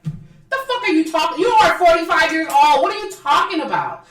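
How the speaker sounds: high, loud incredulous rant; exasperated scolding. Real, not acted. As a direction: tell her off in disbelief. Scolding the absent housewife.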